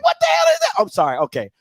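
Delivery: ordinary talk, nothing marked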